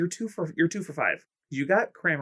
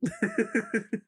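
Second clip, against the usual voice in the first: high laugh. Real, not acted. Laughs at answer.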